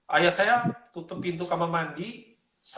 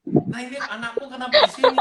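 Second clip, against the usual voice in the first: higher, louder retold astonishment; kaget; 8 semitones higher, 5 dB louder than usual. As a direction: imitate a startled father finding his child. Retelling father's shocked discovery. (meniru ayah yang kaget)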